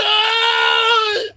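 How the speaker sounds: long high-pitched wail; drawn-out hammed-up cry; mock crying wail. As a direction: imitate a frightened grandma bawling. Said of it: "they probably start crying 'cause they like".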